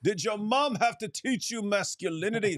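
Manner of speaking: high-pitched mock Egyptian interrogation; hammed-up Egyptian heckling; mock Egyptian intonation. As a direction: grill them in an imitated Egyptian accent. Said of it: "They would have that intonation".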